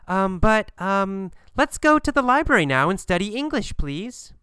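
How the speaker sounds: halting um, then a quick high-pitched plea; timid pleading. Acted. Kathy timidly asks Gabriel to go study English, hesitant then pleading.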